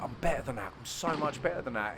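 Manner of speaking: quiet mock self-scolding; self-muttering. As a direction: grumble it to yourself after a bad shot. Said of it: "I'll be mumbling things like".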